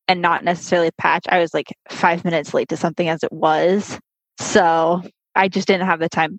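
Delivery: usual speaking voice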